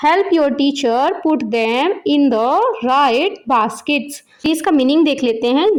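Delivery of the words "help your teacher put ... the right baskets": very slow, word by word reading of the English instruction; slow careful reading. Shown as a demonstration. Teacher reads the book's instruction aloud to parents, very slow and careful. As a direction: spell it out for a beginner, one word at a time. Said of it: "यहाँ पे इसकी pronunciation देख सकते हैं".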